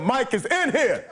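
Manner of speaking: high, drawn-out heckler's call; retold yell. Audience member warns the comic, mock yell. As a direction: call it out slowly across the club. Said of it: "somebody yelled".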